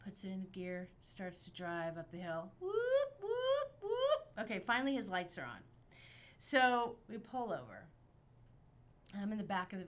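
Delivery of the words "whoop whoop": high whooping siren noise; police siren imitation. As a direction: whoop like a cop car siren.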